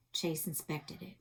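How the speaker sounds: ordinary delivery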